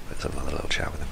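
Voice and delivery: soft unvoiced murmur; hushed gamer aside; whispered aside. Real, not acted. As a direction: whisper it to yourself. Whispered aside while playing.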